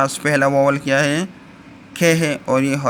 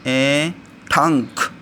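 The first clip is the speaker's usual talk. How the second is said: slow sounding out; much slower than usual. Shown as a demonstration. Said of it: "ये इन हमारे पास इंग की sound देता है".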